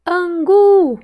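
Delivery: "Angu" with a loud, high, long GU; stretched stressed syllable. Shown as a demonstration. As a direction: call out "Angu", holding GU. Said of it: "Qual que eu demorei mais falar".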